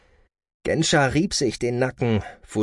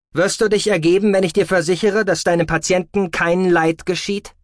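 firm offer in a raised voice; commanding leader; 8 semitones higher, 5 dB louder than usual. A narrator's reading. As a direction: negotiate from command, pitched up and forceful. (bestimmter Anführer, mit erhobener Stimme)

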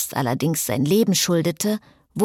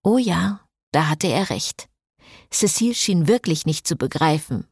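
ordinary delivery, nothing marked

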